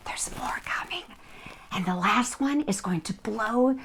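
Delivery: secretive whisper; whispered teaser about the next giveaway; teasing whisper. Acted. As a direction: hush it like a secret.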